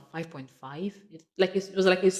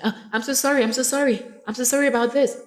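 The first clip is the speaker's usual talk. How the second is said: high, louder flustered apology; acted apology; 9 semitones higher, 6 dB louder, faster than usual. Teacher apologizing to a student over lagging lesson, high and apologetic. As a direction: plead for forgiveness, pitched high and pushed. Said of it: "you're telling your student".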